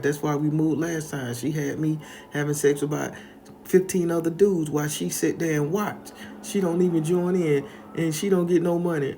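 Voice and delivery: a hint of a tearful young woman confessing; lightly voiced tearful girl. Crying girl confessing to grandma, lightly voiced. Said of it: "just started crying".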